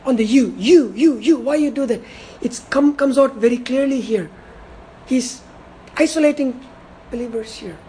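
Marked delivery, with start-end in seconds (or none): 0.0-2.0 you, you, you each pushed a bit louder; stress on you. Shown as a demonstration. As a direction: punch every you in turn. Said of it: "he places the emphatic".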